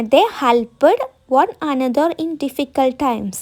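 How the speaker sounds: each word spaced for writing; slow dictation. Shown as a demonstration. Teacher dictating a sentence to students, very slow. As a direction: dictate slowly so students can copy. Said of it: "dictating example".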